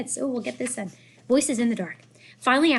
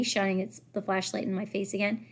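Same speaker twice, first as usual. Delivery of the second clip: plain reading voice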